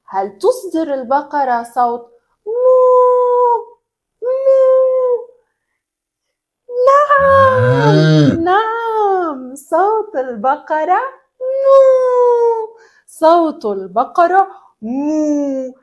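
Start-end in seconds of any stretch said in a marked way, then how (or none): 2.5-5.3 high questioning moo in a cow's voice. Acted. Teacher voicing the cow for the children, high moo. 6.9-8.4 high, very slow, loud drawn-out yes as the cow. Acted. Teacher voicing the cow answering the children, high and loud. 11.4-12.8 high, loud moo. Shown as a demonstration. Teacher mooing for the children, high and loud. Teacher demos cow sound.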